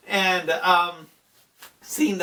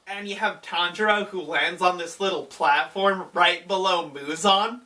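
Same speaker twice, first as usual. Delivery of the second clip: ordinary talk, nothing marked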